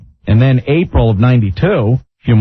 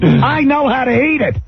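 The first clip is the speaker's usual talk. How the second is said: Regis impression; 10 semitones higher than usual. Mocking Regis on air.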